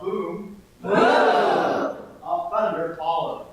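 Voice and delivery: loud slow boom of thunder. A narrator's reading. Narrator booming thunder for listening kids.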